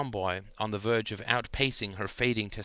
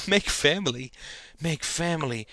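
higher, panting mock-sultry; parody of desire; 6 semitones higher than usual. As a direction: pant it in hammed-up lust. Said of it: "panted Janine in a parody of sexual desire".

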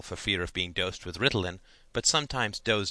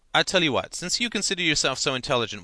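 raised, higher teacher's voice with sarcasm; sarcastic dare; 6 semitones higher, 5 dB louder, faster than usual. A narrator's reading. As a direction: dare the clever pupil scornfully. Teacher sarcastically dares the pupil.

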